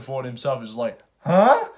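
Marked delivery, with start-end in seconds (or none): 1.2-1.7 shocked huh. Retold or imitated. Harrison Ford shocked by twist.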